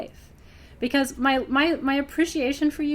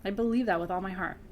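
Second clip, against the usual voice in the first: ordinary talk, nothing marked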